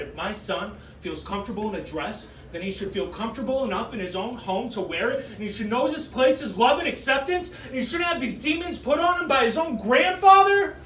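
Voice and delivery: rising into a furious defence; calm start swelling to an angry tirade; sister flipping out. Retold or imitated. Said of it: "my sister flipped out".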